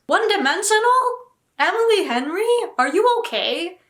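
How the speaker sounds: high, loud disbelieving questions; can't believe what she's reading; incredulous outrage. Real, not acted. Reacting to a review of Emily Henry, loud incredulous questions.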